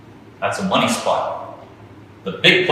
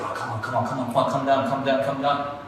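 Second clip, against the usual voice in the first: softer, urgent repeated begging; pleading under the breath; 6 dB quieter, faster than usual. Acted. Trader begging the price to come down, pleading. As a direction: mutter a running plea to the price.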